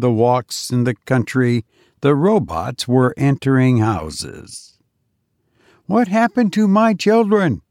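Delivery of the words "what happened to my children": high, pleading demand; a parent terrified for her children; frightened parent. Acted. Parent begging the robot, high and frightened.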